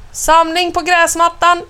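high, carrying instructor's call. A narrator's reading. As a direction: round up the children. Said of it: "ropar Anna". (ropande samling)

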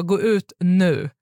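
a slow, firm, mildly angry "Gå ut nu"; slow angry order. Retold or imitated. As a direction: order him out of the car, slow and cold. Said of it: "jag var så arg".

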